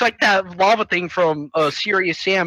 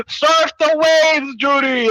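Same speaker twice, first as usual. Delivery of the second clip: drawn-out, high grandpa call; grandpa's call; 6 semitones higher, 3 dB louder, much slower than usual. Retold or imitated. Grandpa urging Judy onto the lava waves, drawn-out call. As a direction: stretch the words out as Grandpa urging him on. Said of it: "then Grandpa's all like".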